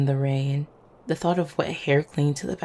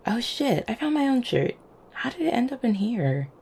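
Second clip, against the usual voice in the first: higher-pitched, pleasantly startled; surprised discovery; 6 semitones higher than usual. Acted. Finding one's own lost shirt, surprised. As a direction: exclaim at an unexpected find.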